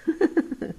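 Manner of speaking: high, real chuckle; delighted laugh. Real, not acted. Former young agent recalling her victories, delighted laugh. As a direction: laugh happily at the memory.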